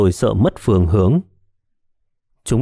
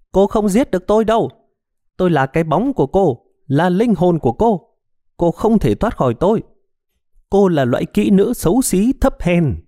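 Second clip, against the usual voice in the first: much higher, sneering ghostly woman; spiteful woman's voice; 9 semitones higher than usual. A narrator's reading. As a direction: taunt her like a venomous spirit. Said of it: "dùng giọng điệu chanh chua nói". (giọng phụ nữ chanh chua, cao vút)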